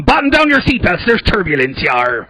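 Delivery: loud, high hammed-up pirate announcement; pirate captain. Pirate pilot on the intercom, hammed-up. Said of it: "waiting for him to get on the intercom".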